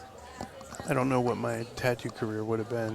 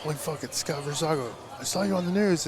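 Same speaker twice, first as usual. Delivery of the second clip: higher-pitched imitation of an excited stranger; starstruck excitement; 5 semitones higher than usual. Stranger recognizing him at the mall, starstruck.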